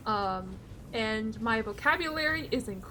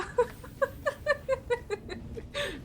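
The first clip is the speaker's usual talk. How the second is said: high giggle; 13 semitones higher, 3 dB quieter than usual. Real, not acted. Laughing at own German.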